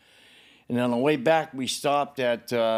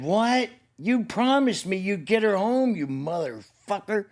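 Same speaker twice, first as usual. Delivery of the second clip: high, heated retold accusation; furious cry at his friend who broke his promise; mad outburst; 7 semitones higher than usual. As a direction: hurl it at the betrayer. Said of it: "Oh, was I mad".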